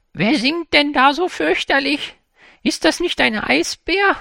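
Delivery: put-on high startled old woman's question; frightened. A narrator's reading. Woken grandmother asking her sister, high frightened question. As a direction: ask it with a jolt of fright. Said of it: "fragte die eine erschrocken".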